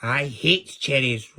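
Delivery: slow, loud, angry roar. A narrator's reading. Wicked queen refusing Angry's cherry cake, roared. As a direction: roar it out, dragging each word. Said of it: "roared the queen".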